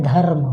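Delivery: धर्म with a half r, dhar-m; the right धर्म, no vowel after र; correct धर्म. Shown as a demonstration. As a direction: say धर्म with r running straight into m. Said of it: "पर हमें लिखना क्या है".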